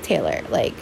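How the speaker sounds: a low, soft imitation of know-it-all friends; mock friends' duh. Imitating her friends telling her it was obvious, low soft mock-exasperated. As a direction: drop low and quiet, playing the friends who saw it coming. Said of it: "my friends are probably like".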